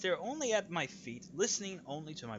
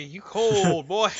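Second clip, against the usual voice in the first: teasing shout; 2 semitones higher, 11 dB louder, much slower than usual. Real, not acted. Teasing cold partner.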